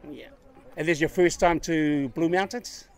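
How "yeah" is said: normal talking voice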